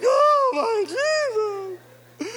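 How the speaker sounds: emotional cry to Jesus; overwhelmed cry. Retold or imitated. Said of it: "And I'm like".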